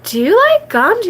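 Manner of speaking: high, far-off friend voice; friend's voice. Retold or imitated. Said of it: "she's like".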